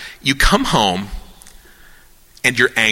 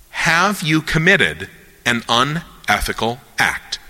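usual speaking voice

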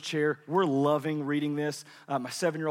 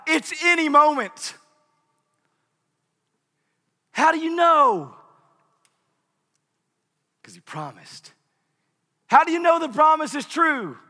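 preaching shout; 10 semitones higher, 10 dB louder than usual. Acted. Preacher building to full force.

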